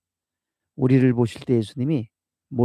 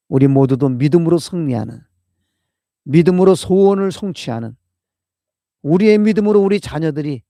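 loud, rising preacher's call; full-force sermon climax; fervent preaching; 5 semitones higher, 9 dB louder than usual. Real, not acted. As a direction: build it up to a loud fervent plea.

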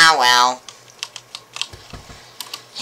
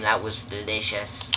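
ordinary talk

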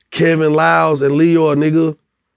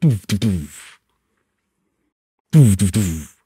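low mouth-drum doof doof doof; low, beatboxed drum thumps; beatboxed kick drum; 9 semitones lower, slower than usual. Retold or imitated. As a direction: imitate the beat with your mouth. Imitating the beat.

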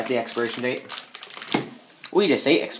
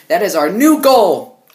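louder, slow proud declaration; emphatic announcement; 5 semitones higher, 6 dB louder, slower than usual. Real, not acted. Vlog outro announcing goal.

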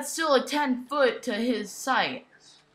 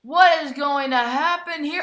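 ordinary talk, nothing marked

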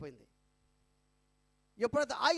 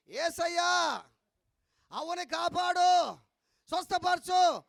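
shouted, slow, higher prayer; loud prayer; 7 semitones higher, 8 dB louder, much slower than usual. Retold or imitated. Pastor praying loudly over the deaf old woman, shouting. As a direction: belt the blessing out so she hears it. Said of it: "నాకు చెవులే చిన్నగా చెప్పు".

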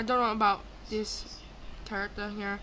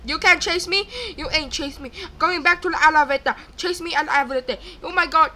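loud, raised-pitch chase taunts; excited taunting; 5 semitones higher, 9 dB louder than usual. Real, not acted. Player fleeing to the elevator, excited taunting. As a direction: shout taunts on the run.

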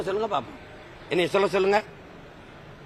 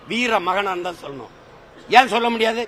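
ordinary talk

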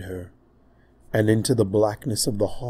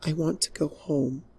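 high, soft, tearful plea; weary princess begging; quiet plea; 6 semitones higher, 5 dB quieter than usual. Acted. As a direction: ask like a lost child. Princess pleading softly.

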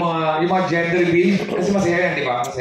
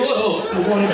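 usual speaking voice

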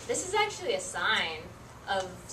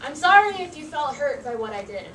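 fake apology; 5 semitones higher, 7 dB louder than usual. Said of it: "What about this?".

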